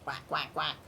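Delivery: low mock duck quacking; duck quacks. Shown as a demonstration. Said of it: "Can you make those noises?".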